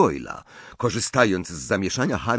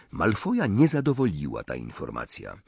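ordinary delivery, nothing marked

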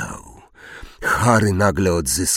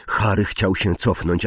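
plain reading voice

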